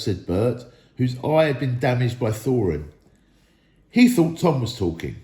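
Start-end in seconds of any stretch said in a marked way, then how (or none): none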